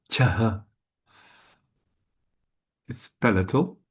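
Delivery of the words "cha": "Cha" with a puff of air after the ch; aspirated cha. Shown as a demonstration. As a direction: release "Cha" on an audible breath. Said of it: "Aspirated cha".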